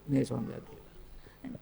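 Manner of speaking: ordinary talk, nothing marked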